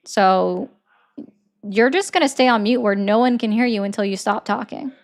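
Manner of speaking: ordinary talk, nothing marked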